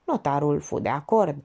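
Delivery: usual speaking voice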